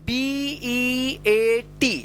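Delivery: letters drawn out one by one; stretched, separate letters; slow spelling. Shown as a demonstration. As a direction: spell it slowly for beginners. Teacher spells out word for learners.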